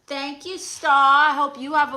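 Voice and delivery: loud, bright shout-out to a viewer; sudden loud welcome; excited greeting. Real, not acted. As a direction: call the name out with delight.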